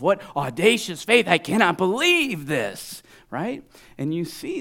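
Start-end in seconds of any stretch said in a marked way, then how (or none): 0.0-3.0 high, loud astonishment; incredulous full-voiced marvelling; amazed exclamation. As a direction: cry out as if overwhelmed by wonder. Preacher voices Jesus amazed.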